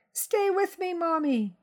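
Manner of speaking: put-on high, small child's begging voice; cling to your mother with your voice; pleading little boy. Frightened son begs his mother to stay, high childlike plea.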